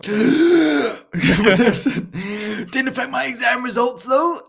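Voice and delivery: high, loud laughing comeback; laughing retort. Real, not acted. Laughing back at his mate's asthma jibe, loud. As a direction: shout it through a laugh.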